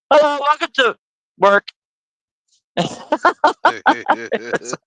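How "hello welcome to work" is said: higher chirpy cashier greeting; greeting clerk. Retold or imitated. Host imitating Love's cashiers greeting customers, higher chirpy voice. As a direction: sing out the store greeting. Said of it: "she goes".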